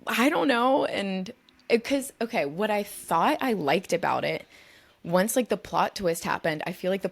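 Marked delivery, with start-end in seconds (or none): 0.1-0.9 flustered. Retold or imitated. Retold tongue-tied answer to actor.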